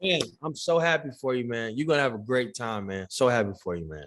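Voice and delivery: low, understated congratulations; mildly sarcastic. Real, not acted. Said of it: "you're being a little sarcastic".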